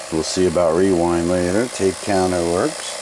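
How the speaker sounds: ordinary talk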